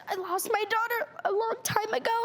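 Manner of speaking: fighting tears on the quote; real tears catching in the throat; crying. Real, not acted. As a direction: voice breaking while quoting.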